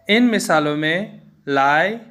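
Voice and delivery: ordinary delivery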